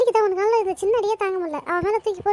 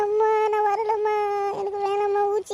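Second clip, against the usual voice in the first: whiny crying. Acted. Child begs mother to skip the injection, whiny crying. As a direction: whine on one note, begging.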